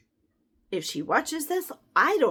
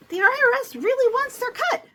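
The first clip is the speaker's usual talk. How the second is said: emphatic warning; 10 semitones higher, 8 dB louder than usual. Real, not acted. Warning about taxes.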